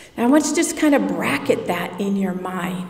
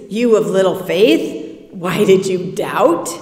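"You of little faith" said lower and louder, scolding; chastising, disappointed; 3 semitones lower, 3 dB louder than usual. Shown as a demonstration. Jesus chastising a sinking Peter, disappointed. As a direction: rebuke him, disappointed. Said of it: "as Jesus chastising Peter and disappointed".

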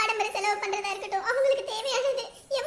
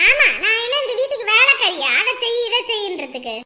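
loud, scornful retort with a laugh; angry retort; 3 semitones lower, 7 dB louder than usual. Acted. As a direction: fire it back at her in contempt. மாமியாருடன் சண்டை. (கோபமான எதிர்ப்பு)

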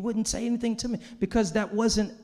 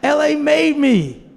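proud declaration; 9 semitones higher, 11 dB louder, slower than usual. Retold or imitated. LA pride, then echoed.

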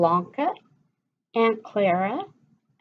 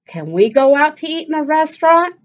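higher, louder fast pleading; begging; 7 semitones higher, 8 dB louder, faster than usual. Acted. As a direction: plead with mom quickly. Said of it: "Anita begged".

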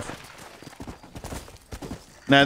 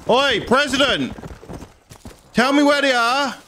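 calling out; 6 semitones higher than usual. Pretend. Calling out to game president.

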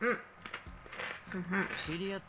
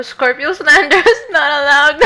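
loud, high playful scold; mock scolding; 5 semitones higher, 11 dB louder than usual. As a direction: scold the chat in jest.

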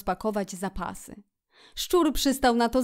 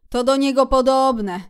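troubled; 4 semitones higher, 9 dB louder than usual. A narrator's reading. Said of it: "rzekł strapiony szczur".

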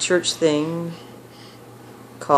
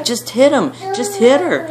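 egging on; 9 semitones higher than usual. Retold or imitated. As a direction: urge the fight on. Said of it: "the dude was like".

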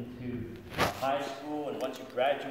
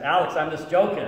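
laughing, louder, telling him it's a joke; laughing reassurance; 3 semitones higher, 11 dB louder than usual. Retold or imitated. To a shocked friend on the phone, laughing and much louder. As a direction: blurt it out through laughter.

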